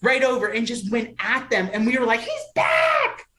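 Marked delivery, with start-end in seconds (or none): 2.2-3.3 very high, jubilant scream; imitated crowd squeal; freaking out. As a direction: shriek it like a fan whose hero revives. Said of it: "Freaking out".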